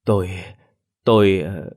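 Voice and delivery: halting, at a loss for words; stammering. A narrator's reading. Lâm stammering under his wife's accusations, halting. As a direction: stumble helplessly. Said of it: "ông Lâm liên tục ú ớ".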